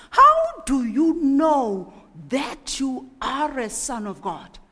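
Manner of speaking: loud, slow, challenging tempter. Acted. Tempter challenging Jesus in the wilderness: loud and slow. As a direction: push the doubt hard and loud. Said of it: "the tempter is saying to Jesus".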